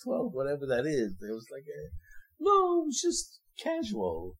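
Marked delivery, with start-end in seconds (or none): 2.4-4.4 much higher, airy and slowed, sarcastic; mock casual. Preacher joking that centuries in Egypt were casual, high and airy. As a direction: toss it off as if slavery was nothing.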